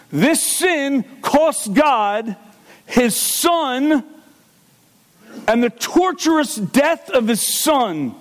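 slow impassioned preacher voice; intense preaching. Acted. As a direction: lean into each word like a pulpit warning. Preacher builds intensity on sin.

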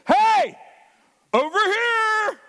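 loud, high, drawn-out call; mock shout. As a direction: yell it across a room, hammed up. God calling out loudly.

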